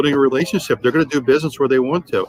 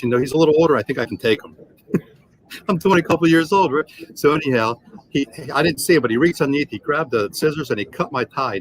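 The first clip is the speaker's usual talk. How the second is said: ordinary talk, nothing marked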